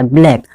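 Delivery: "ब्लैक" as one syllable, BLAIK; wrong pronunciation "ब्लैक". Shown as a demonstration. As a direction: say "ब्लैक" with bl together and an "ai" vowel. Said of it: "जो उच्चारण है गलत हो जाता है".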